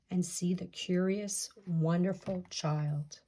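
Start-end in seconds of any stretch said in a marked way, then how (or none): none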